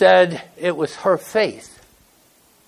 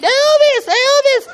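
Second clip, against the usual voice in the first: imitating a screaming fan; 4 dB louder, slower than usual. Wife screaming for [name] at the concert, high excited squeal. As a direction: shriek it like a starstruck fan.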